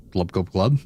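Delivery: fish gulping glub; gurgling fish man croak. Shown as a demonstration. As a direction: mouth a fish's glubs. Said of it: "the face that this guy's making".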